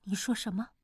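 hushed, slightly trembling question. Acted. Stirred by talk of revenge, hushed and trembling. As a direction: ask softly with a touch of tremor. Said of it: "她颤声道".

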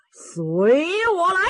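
very slow, rising opera call; high, drawn-out declamation; opera recitative. A narrator's reading. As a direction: stretch each word like a stage hero's summons. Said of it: "就别道白了".

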